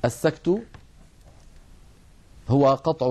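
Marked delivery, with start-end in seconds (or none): none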